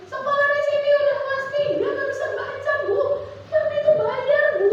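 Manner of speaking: high, loud indignant parent demanding answers; complaining parent. Retold or imitated. Upset parent confronting the school head, high and loud. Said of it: "saya pernah dioncongin kayak gitu".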